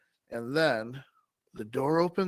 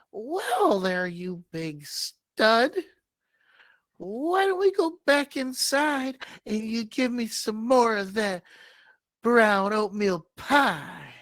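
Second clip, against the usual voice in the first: Olga's sultry voice; 8 semitones higher, 6 dB louder than usual. Acted. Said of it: "She says to Omar".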